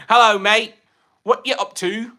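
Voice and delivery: a half-hearted mock British accent; British accent attempt. Streamer greeting chat in a mock British accent. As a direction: try a British greeting, not quite landing, in a hint of a British accent. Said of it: "that's not British. That's Australian".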